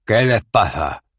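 espeta lento y más fuerte; espetando. A narrator's reading. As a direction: Cohen corta a Dos Flores, seco. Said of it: "le espetó Cohen". (espetando, más fuerte)

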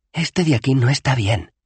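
put-on high, clear young woman; Bethan's voice. Bethan pointing out the bad spell, high and firm. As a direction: point it out firmly.

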